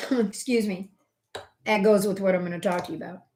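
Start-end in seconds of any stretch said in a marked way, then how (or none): none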